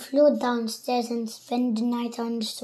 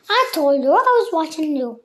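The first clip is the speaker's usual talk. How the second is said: louder, quick witchy taunt; cackling Missus Twit; 3 semitones higher, 8 dB louder, faster than usual. A narrator's reading. Mrs Twit taunting her husband, cackling. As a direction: cackle it at him like a gleeful hag. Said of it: "cackled Missus Twit".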